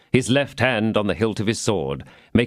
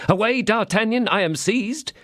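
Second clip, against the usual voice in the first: retold cry; 12 semitones higher than usual. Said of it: "he cried out".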